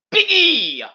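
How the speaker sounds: high, loud ring announcer call; a quick burst of announcer hype. Pretend. Hyped ring announcer intro.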